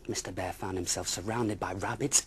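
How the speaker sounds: plain reading voice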